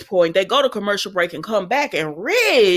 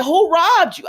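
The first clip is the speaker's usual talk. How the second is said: higher and louder outraged question; indignant surprise; 8 semitones higher, 5 dB louder than usual. Real, not acted. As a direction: demand it in disbelief. Reacting indignantly to the show.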